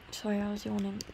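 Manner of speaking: ordinary talk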